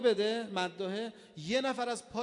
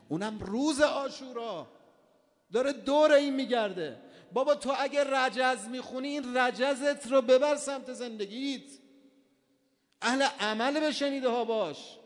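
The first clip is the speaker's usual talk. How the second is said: higher, much louder climactic sermon; preaching at full force; 4 semitones higher, 6 dB louder than usual. Acted. Preacher exhorting mourners to act on what they hear, loud and higher. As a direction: drive the lesson hard into the crowd.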